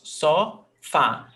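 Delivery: "Sofá" split as so, fá, stress on FÁ; syllable by syllable. Shown as a demonstration. Teacher counting syllables for students, "Sofá" in two separate beats. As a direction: break "Sofá" in two, landing on the final FÁ. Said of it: "Two syllables".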